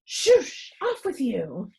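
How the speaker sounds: high, loud shoo; sharp shooing cry, then brisk; shooing sharply. A narrator's reading. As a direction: shoo the woman out of the room. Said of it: "in a harsher tone".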